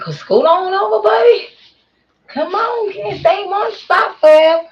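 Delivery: high, loud, exasperated call to move along; acted-out nagging holler. Retelling telling buddy to scoot over, high loud nagging.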